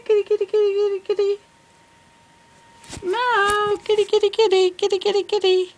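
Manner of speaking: high, loud sing-song cat call; coaxing the cat from far away. Real, not acted. Calling the cat over Skype.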